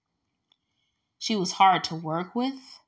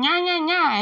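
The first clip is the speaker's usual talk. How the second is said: high, louder childish nya nya, imitating a coworker; mocking taunt; 10 semitones higher, 5 dB louder than usual. Imitating a coworker's taunt to her, mocking nya nya. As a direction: mimic a taunting coworker, sing-song.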